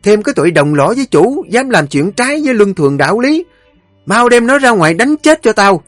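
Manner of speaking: loud, high, fast tirade of commands; mother-in-law raging at the servants, shouting orders; furious matriarch. Acted. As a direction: bark orders to have the maid beaten.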